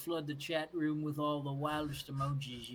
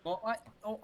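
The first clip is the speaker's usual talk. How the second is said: ordinary talk, nothing marked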